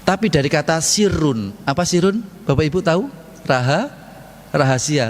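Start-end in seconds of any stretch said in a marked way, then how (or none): none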